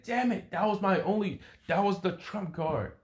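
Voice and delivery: high-pitched exasperated complaint; frustrated. Acted. Fighter's partner just missed the shot, frustrated.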